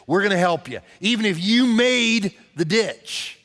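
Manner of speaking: rising sermon intensity, pleading with the congregation; forceful preaching. Acted. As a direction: drive it home from the pulpit. Preacher building to full force.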